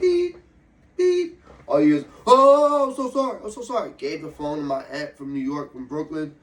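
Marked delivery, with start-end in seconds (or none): 0.0-1.4 high, loud electronic beep; imitated beep. Man imitating his phone on speaker, high loud beep. 2.2-3.9 high wailing "oh", then tumbling sorrys; exaggerated high-pitched grovelling, easing off; mock flustered apology. Mimicking the professor caught out on speakerphone, high-pitched panicked apology.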